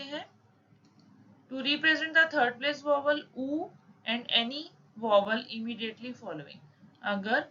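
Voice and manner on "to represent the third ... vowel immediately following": reads the textbook rule out slowly, word by word; slow rule reading. Shown as a demonstration. Teacher reading a shorthand rule aloud to students, slow dictation. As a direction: dictate the rule at half speed.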